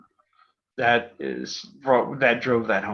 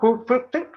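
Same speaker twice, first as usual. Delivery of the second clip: high squeaky whoop whoop ding; scroll unrolling noise; 13 semitones higher, 4 dB louder than usual. Retold or imitated. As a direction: mimic a long list unrolling with a high squeak. Scroll unrolling sound imitated.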